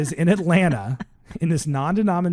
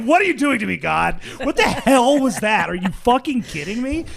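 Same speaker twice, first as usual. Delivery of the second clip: higher, louder outburst at God; mock outraged rant; 10 semitones higher, 5 dB louder than usual. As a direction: act out an indignant tirade, pitch jumping up. Acted rant at God, mental breakdown.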